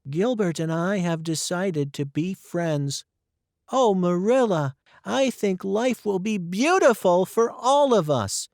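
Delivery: overflowing with hope for the future; girl's joyful speech; Anne joyful. Acted.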